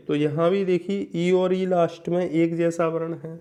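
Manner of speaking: normal talking voice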